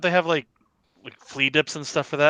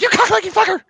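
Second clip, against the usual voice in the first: much higher, loud blurted yell; mock tic yell; 4 dB louder than usual. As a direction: shout it like a sudden tic. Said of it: "Just walk around and be like".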